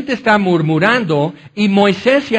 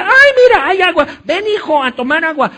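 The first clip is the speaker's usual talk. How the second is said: much higher and louder, gleeful put-on voice; delighted villager; 12 semitones higher, 5 dB louder than usual. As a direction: squeal with joy at the water. Happy people find water. (la gente feliz llama al hijo a beber, voz aguda y alegre)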